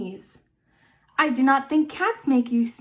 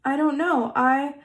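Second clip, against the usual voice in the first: plain reading voice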